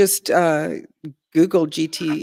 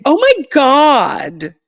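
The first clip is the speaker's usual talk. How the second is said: put-on slow, drawn out, higher and louder Valley Girl "oh my God"; 10 semitones higher, 8 dB louder, much slower than usual. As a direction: ham up the Valley Girl gasp. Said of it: "It's like when they say".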